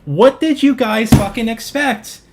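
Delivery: loud, drawn-out exasperated outburst; exasperated yell. Real, not acted. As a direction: throw the question at them, fed up. Exasperated rant at buyers.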